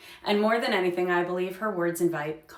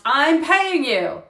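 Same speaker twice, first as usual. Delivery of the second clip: slow, loud, furious demand; angry shout; 7 semitones higher, 9 dB louder, much slower than usual. Retold or imitated. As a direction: bellow it at the band. Host storms up, stops loud music.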